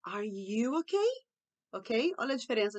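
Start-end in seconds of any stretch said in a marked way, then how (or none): 0.1-1.1 slow "Are you okay", rising on "okay"; question tune. Shown as a demonstration. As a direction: ask "Are you okay" slowly, lifting the end. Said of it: "a gente tá fazendo uma pergunta". (pergunta lenta, subindo em "okay")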